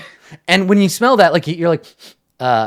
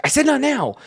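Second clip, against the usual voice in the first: irritated snap; 13 semitones higher than usual. Acted. Guard snapping a second time.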